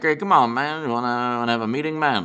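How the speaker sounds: mock politician voice, words run together; slurred, rambling impression of the president; Biden. President asking Germany's leader for a meeting, mock impression. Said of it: "Biden's like".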